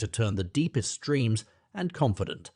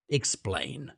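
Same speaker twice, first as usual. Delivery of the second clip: scornful echo; 4 dB quieter than usual. A narrator's reading. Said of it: "snatching up Rosamond's words again".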